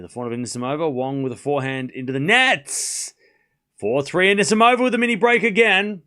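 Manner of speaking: live tennis commentary, rising high on big points; play-by-play call. Acted. Streamer calling the tiebreak for chat, live play-by-play. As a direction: narrate every shot as it lands.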